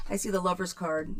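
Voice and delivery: ordinary delivery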